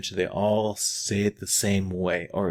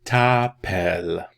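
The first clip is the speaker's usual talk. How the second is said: loud, stretched "t'appelles"; slow syllables; 6 dB louder, slower than usual. Shown as a demonstration. Teacher breaks down the verb for learners, slow loud "t'appelles". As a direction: split "t'appelles" into two long syllables. Teacher sounds out the word slowly.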